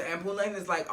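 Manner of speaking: normal talking voice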